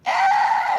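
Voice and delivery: very high squeal, close to a giggle; giddy squeal. Retold or imitated. Friend squeals with glee at the dinner table. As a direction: let out a delighted squeal. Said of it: "He was g-, almost giggling".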